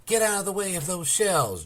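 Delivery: high, loud warning cry; shouted warning. A narrator's reading. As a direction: yell at them to flee. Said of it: "Jenny shouted".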